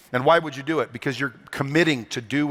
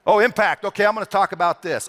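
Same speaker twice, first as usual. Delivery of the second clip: eager pick; 6 semitones higher, 3 dB louder than usual. Pretend. Voicing user picking answer.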